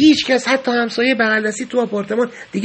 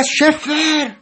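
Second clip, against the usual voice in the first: drawn-out call, mildly raised voice; retold yell; 5 semitones higher, much slower than usual. Prisoner abruptly calling out for Shefer, mock yell. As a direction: imitate a sudden yell, stretching the name. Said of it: "یهو داد زد".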